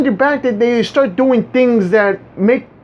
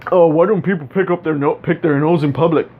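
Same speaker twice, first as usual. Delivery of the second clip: mock clueless adult; 7 semitones lower than usual. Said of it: "if you met an adult who said this".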